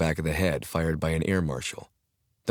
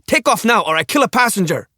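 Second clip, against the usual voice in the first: sharp high scream; frantic scream at the pilot; 8 dB louder than usual. Acted. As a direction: bark the destination as a scream. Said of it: "Jelak screamed every word".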